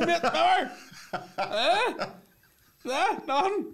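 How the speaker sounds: very high, hopeful calls; mock pleading, pitched right up; fishing for recognition. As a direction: prod a stranger for any reaction. Acting out getting no recognition.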